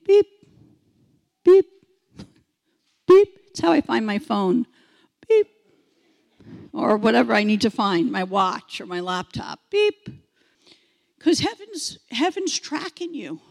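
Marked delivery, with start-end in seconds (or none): 0.1-3.3 short, high, loud beacon beeps; imitated electronic beep. Shown as a demonstration. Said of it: "it's like a beacon". 5.3-5.5 short, high, loud beacon beeps; imitated electronic beep. Shown as a demonstration. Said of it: "it's like a beacon". 9.7-10.1 short, high, loud beacon beeps; imitated electronic beep. Shown as a demonstration. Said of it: "it's like a beacon".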